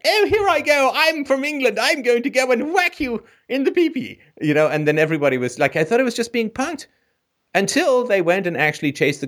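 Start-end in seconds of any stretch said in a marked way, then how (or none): 0.0-4.2 lightly voiced eager English kid, much higher; a high, mock-innocent boy from England ready to join in; mock naive newcomer. New English boy joining recess game, mock-eager high voice. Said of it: "So that I'm like".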